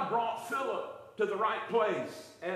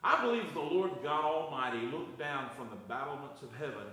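ordinary delivery

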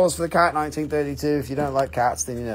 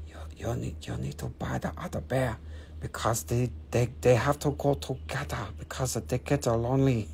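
lightly voiced bear, soft Chinese English accent; hushed Chinese English sales pitch from the bear; 6 semitones lower, 8 dB quieter than usual. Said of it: "the bear's talking to you in your ear in Chinese English".

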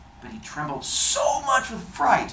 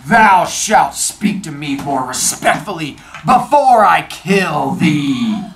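loud, slow menacing genie; 7 dB louder, slower than usual. Acted. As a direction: threaten slowly and loudly. Genie threatens the fisherman.

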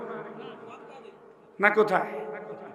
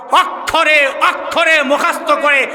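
louder, higher scolding sermon; fiery preaching; 5 semitones higher, 3 dB louder than usual. Acted. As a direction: denounce the singers to the crowd. Preaching at full force, scolding. (উচ্চস্বরে ভর্ৎসনাময় ওয়াজ)

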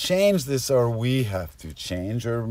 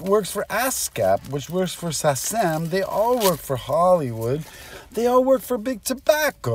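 lightly voiced character, pitched higher; higher voice climbing through the list; Reynolds; 6 semitones higher than usual. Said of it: "Reynolds is like".

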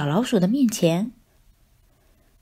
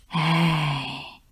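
soft long sigh; 4 semitones lower, 3 dB quieter than usual. Acted. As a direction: let out a long heavy sigh. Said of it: "长长的叹了一口气". (大肥猫长长地叹气)

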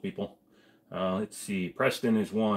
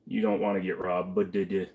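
usual speaking voice

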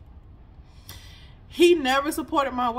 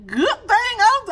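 laughing; 9 semitones higher, 8 dB louder, slower than usual. Real, not acted. Laughing mid-sentence.

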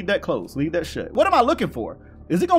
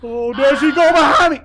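high, loud startled wail; alarmed cry; 8 semitones higher, 7 dB louder than usual. Real, not acted. Gamer spotting monster behind him, alarmed cry. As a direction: cry out as she turns up.

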